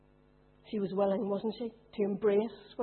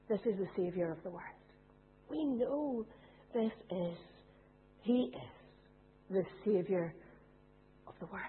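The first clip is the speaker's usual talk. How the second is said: hushed reverence; 4 semitones lower, 7 dB quieter than usual. Acted. Preacher closing the verse, hushed and reverent. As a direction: let it fall quiet and holy.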